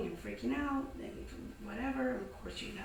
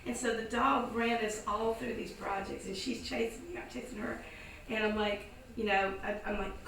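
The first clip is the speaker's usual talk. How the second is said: ordinary talk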